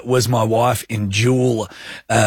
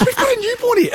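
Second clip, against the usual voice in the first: very high mock-furious wife voice; faster than usual. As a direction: squeak it out like an outraged wife. Retelling angry wife's scolding.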